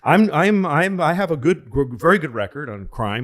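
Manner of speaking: high, loud, rushed stammering dodge; flustered politician. Retold or imitated. Reporter presses him, so the candidate stammers back high and loud. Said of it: "He goes".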